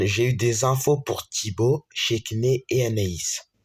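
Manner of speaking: plain reading voice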